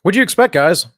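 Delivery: loud, high exasperated cry; exasperated shout. Real, not acted. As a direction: throw up your hands, loudly. Exasperated outburst.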